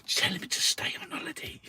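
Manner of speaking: fast, hushed conspiratorial whisper; sneaky whispered aside; mock whisper. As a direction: whisper it like a sly plot. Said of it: "He's like".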